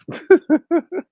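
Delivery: high-pitched laugh. Real, not acted. Laughs at his own bit.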